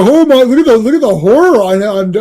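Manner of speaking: high, loud mock horror; horrified outburst. Comic fan voicing an onlooker to carnage, hammed-up horror. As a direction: wail it in hammed-up dismay.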